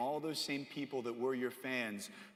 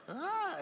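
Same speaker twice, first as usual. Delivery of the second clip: high, held dazed "ah"; loopy drugged moan. Retold or imitated. Sister on loopy drugs after surgery, dazed moan. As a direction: imitate a woozy high-pitched moan. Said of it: "she's on some loopy drugs".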